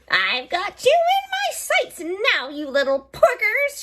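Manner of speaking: high, loud gloating hag; cackling witch. A narrator's reading. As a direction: gloat down at the prey. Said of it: "she cackled".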